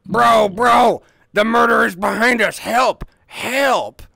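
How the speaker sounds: loud high panicked shouting; being chased. Pretend. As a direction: scream for help as if chased. Faking chase, calling for help.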